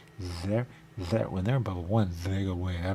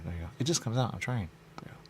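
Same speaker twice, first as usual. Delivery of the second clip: ordinary delivery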